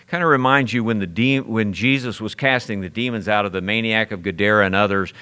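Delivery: ordinary talk, nothing marked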